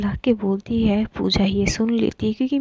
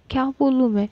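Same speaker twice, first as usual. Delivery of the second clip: usual speaking voice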